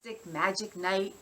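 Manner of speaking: plain reading voice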